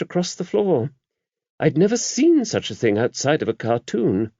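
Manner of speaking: mother superior confiding in a priest; the abbess. A narrator's reading. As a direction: voice the abbess kindly and knowingly. Abbess voiced in the story.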